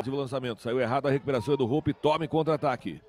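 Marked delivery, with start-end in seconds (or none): none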